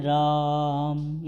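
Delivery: "राम" stretched over three beats, slow, as if calling out; prolonged calling vowel. Shown as a demonstration. Teacher showing the three-beat vowel used in calling. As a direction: draw out "राम" like calling someone from a stage. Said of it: "जिसको पुकारने में नाटक के संवादों में प्रयोग".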